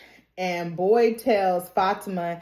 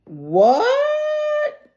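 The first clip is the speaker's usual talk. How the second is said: very high, loud what; jolted disbelief; squeaking in shock; 4 dB louder than usual. Real, not acted. Viewer shocked reaction.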